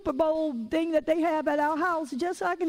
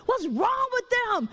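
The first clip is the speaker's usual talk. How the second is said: high, drawn-out mock fussing; retold fussing; 7 semitones higher, slower than usual. Wife imitates her husband fussing at the people that played, mock indignation. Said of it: "My husband was fussing at the people that played".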